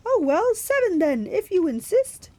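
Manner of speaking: high falsetto, coyly giving in; loud, very high bridal sigh of agreement; Grover. A narrator's reading. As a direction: concede like a fake bride.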